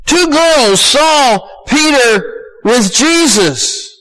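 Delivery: slow, high, word by word; high-pitched, spaced-out preaching emphasis; emphatic. Real, not acted. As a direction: drive each word home, slowly. Preacher stresses the point.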